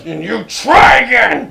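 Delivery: hammed-up yell; mock shout. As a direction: bellow it back in mock outrage.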